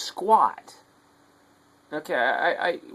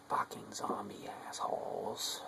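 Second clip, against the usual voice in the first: slow hissed whisper of contempt; angry whisper; 4 semitones lower, 14 dB quieter, slower than usual. Real, not acted. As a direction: whisper the insult so the dog won't hear. Dog stressed, so he whispers.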